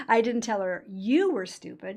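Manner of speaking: strong emphasis landing on "you"; stress on YOU. Shown as a demonstration. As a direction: hit the word "you". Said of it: "Everybody else is stupid".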